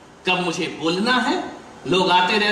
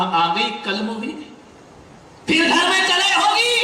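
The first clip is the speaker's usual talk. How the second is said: mother-in-law's contemptuous rant; scornful scolding; 8 semitones higher than usual. Retold or imitated. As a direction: sneer then shout at the bride. Mother-in-law scolds the returning bride.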